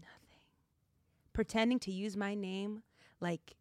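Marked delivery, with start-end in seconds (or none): 0.0-0.4 faint whispered echo. Real, not acted. Host echoing the cohost in a whisper. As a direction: barely breathe the word.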